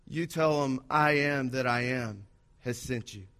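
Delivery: low, soft voice of God. Acted. God answering Moses, low and solemn.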